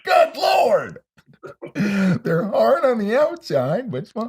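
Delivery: high, loud cartoon voice; mock falsetto. Riffing on the tap dancing woman in a shouted falsetto. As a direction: squeal it like a cartoon lady.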